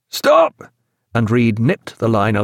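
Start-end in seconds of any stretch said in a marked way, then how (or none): 0.1-0.6 high one-word yell; bawled order. A narrator's reading. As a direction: bawl it out to halt the count. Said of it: "he bawled".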